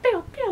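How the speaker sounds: pew, pew popped out high and light; little zap noises, playfully. Pretend. Mimicking weapon sounds.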